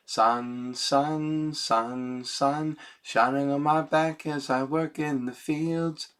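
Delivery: a hint of Jamaican accent, sung out. Shown as a demonstration. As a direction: lilt it like a Jamaican singer. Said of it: "almost with a Jamaican accent".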